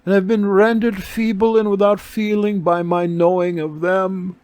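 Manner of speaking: a crippled beggar sighing over her dead hands; sighing beggar woman. A narrator's reading.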